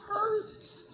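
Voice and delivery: fighting back tears and losing; voice breaking. Real, not acted. Mother talking to her viewers about her sons, voice breaking into tears. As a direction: voice cracks way up on hurts, near tears. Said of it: "I'm trying so hard not to cry".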